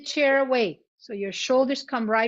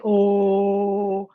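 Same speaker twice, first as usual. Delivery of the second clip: long low oh, firmly projected; deep "oh"; 4 semitones lower, 5 dB louder than usual. Shown as a demonstration. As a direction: hold a deep oh on one note. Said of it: "as deep as we can".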